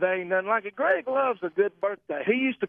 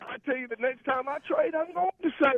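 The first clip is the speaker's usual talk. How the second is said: hard sell ad; 7 semitones higher than usual. Retold or imitated. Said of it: "Do you love his radio voice?".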